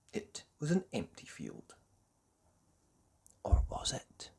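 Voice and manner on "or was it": whispered teasing question; suspense whisper. A narrator's reading. Narrator hinting danger to listeners, whispered. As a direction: drop to a hushed whisper.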